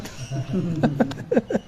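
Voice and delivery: quiet rippling laugh; teasing chuckle. Real, not acted. Teacher teasing those with earrings, quiet chuckle. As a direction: chuckle softly after a tease.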